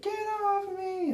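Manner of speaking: high, piercing shriek of panic; mock scream. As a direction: shriek it like a woman pushing a man off. Actress fighting off scene partner.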